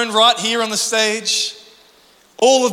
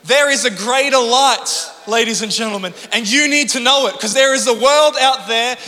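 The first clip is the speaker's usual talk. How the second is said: rising, louder pulpit exhortation; full-force preaching; 3 semitones higher, 4 dB louder than usual. Acted. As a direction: press it home to the congregation.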